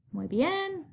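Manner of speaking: high, soft teacherly praise; soft high-pitched well done. Real, not acted.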